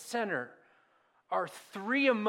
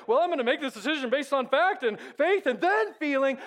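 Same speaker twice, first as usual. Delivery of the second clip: mock rational voice; 5 semitones higher, 4 dB louder than usual. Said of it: "instead of feeling like".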